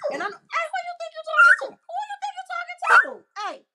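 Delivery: high, loud scolding; scolding the dog. Real, not acted. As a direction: snap at the rude dog to back off.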